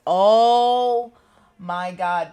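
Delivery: a loud, high, delighted gasp of oh; excited oh. Real, not acted. Tarot reader thrilled by the perfect match card, loud excited oh. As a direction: burst out with joy at the card.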